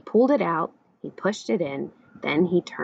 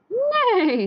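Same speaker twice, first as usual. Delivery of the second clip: high, long neigh that falls; whinny the word, sliding down; horse neigh; 4 dB louder than usual. A narrator's reading. Horse clambering onto the tractor, neighing. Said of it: "said Horse".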